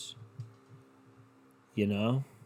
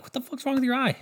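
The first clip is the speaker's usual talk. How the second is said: put-on high, odd little-kid voice, blunt; mocking toddler; 13 semitones higher than usual. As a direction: play a rude toddler mocking a lazy eye. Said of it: "They'll be like".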